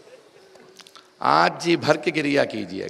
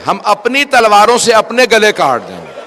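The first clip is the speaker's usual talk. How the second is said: loud vow; 7 semitones higher, 8 dB louder than usual. Retold or imitated. Habib vows the companions' lives, loud high cry. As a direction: vow shouted out, louder and much higher. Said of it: "آواز دی".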